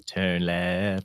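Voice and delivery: low navigation voice, lightly imitated; a hint of satnav; GPS voice. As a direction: deliver it as a low GPS prompt. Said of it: "it sounded exactly like it".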